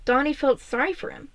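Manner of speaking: ordinary delivery, nothing marked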